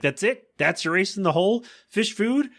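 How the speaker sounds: mocking disbelief at fish food; mocking scoff. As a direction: sneer at the weak attack. Peter mocking Lois's weapon.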